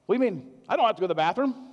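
put-on indignant old man brushing it off; mild mock indignation; imitating an indignant patient. Patient quoted contradicting himself.

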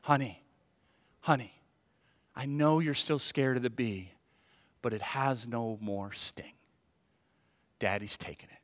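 put-on calm a scared child, with pauses; soothing father. Dad reassuring daughter the bee can't sting, soothing.

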